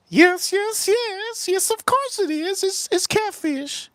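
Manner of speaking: very high put-on Tennessee aunt voice; squeaky mock-Southern aunt insisting it is catfish. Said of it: "this is how she talks, 'cause she's from Tennessee".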